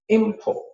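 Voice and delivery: import with high IM, falling port; noun stress. Shown as a demonstration. Teacher repeating the noun with rice, first-syllable stress on import. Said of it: "capital letters are the stressed parts".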